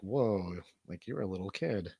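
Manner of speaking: ordinary talk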